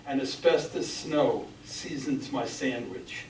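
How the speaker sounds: measured, solemn poetry recital; grave spoken verse, firmly projected; poem reading. Acted. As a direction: read it out in deliberate phrases, pausing after each. Reads own poem aloud.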